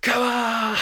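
high, loud rallying shout; excited cheer. Shown as a demonstration. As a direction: pump up the crowd. Rousing cheer after song.

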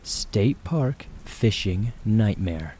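slow, deliberate title read; title announcement. A narrator's reading. Reads story title.

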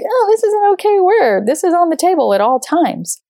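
high, breezy mock-naive voice; mock carefree. Mocking carefree attitude to swearing.

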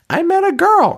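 put-on very high, squeaky little-kid voice; mock little kid Justin announcing his news. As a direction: squeak it like an excited small boy. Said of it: "Justin's like".

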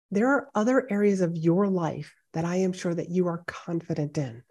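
ordinary talk

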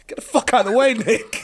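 high mock yell, half laughing. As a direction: yell at the teammate to move, but hammed up. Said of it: "I remember going".

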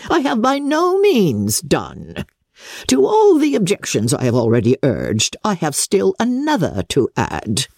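put-on indignant aristocrat scolding a young woman; lightly voiced imperious old lady; Lady Catherine voice. A narrator's reading. Lady Catherine's haughty speech.

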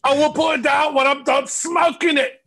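high-pitched, hammed-up character yell; mock shouting. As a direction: play a comically outraged argument at a yell. Acts out a comic crack argument.

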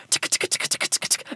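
whispered clock ticks; ticking. Pretend. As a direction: tick softly like a clock.

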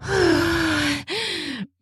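high falling sigh of pleasure; pleasured sigh. Real, not acted. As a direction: let out a long pleasured sigh. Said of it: "this toy is feeling good".

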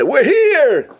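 high, loud cheerful call; excited arrival. Acted. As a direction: announce yourselves at the door. Family announces arrival.

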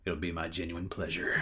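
low, soft hero reply; mock-earnest Hawkeye. Hawkeye accepts his captain's battle order, low and mock-earnest. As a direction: imitate an understated, cheesy action hero.

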